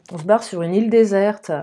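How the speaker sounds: ordinary talk, nothing marked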